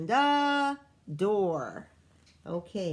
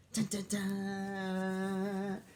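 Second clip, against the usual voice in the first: slow dramatic dun dun dun; 3 dB quieter, much slower than usual. Acted. Teacher reading to kids, slow suspense sting. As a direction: stretch each dun slowly for suspense.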